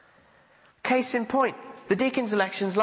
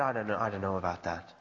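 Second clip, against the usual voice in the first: much lower and softer, rushed, muttering his doubt; quiet doubting mutter; 11 semitones lower, 6 dB quieter, faster than usual. Retold or imitated. Preacher retelling his doubt about fruitfulness, quick soft mutter. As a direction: mutter it fast under your breath, unconvinced.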